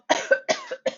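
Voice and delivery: high, thin squeaky cough; mock cough. A narrator's reading. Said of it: "please pardon my cough".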